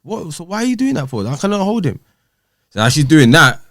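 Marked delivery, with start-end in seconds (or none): 0.0-2.0 much higher, baffled protest; father refused his son, baffled; incredulous. Retold or imitated. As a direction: keep it up high, can't believe this. His shocked reaction retold.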